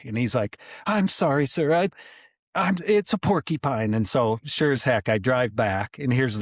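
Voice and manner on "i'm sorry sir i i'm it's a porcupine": put-on nervous kid. Scared kid pleading.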